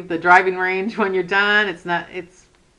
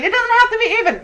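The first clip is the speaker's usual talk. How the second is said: high, laughing mock of the viewers; imitating the viewers with a laugh; 14 semitones higher, 5 dB louder, much faster than usual. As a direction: parrot the comment back, teasing. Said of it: "you're all saying".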